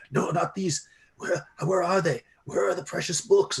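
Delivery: a half-mad scholar pleading for his lost books; frantic scholar. Acted.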